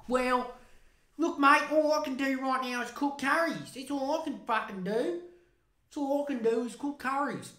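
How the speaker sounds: high, whiny mock excuse; mock pleading PM. Mimicking the PM excusing himself, high and whiny. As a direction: pitch it up like a helpless bloke.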